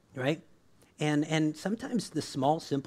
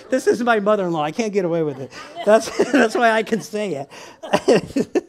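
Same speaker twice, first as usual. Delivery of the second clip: loud and giggling, words stretched out by laughter; can barely get it out for laughing; 5 semitones higher, 7 dB louder than usual. Real, not acted. Preacher jokes about her mother-in-law, laughing hard.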